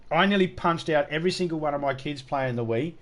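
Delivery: normal talking voice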